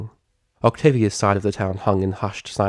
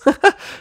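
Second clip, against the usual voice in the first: squeaky pony giggle; giddy high laugh; 4 dB louder than usual. Acted.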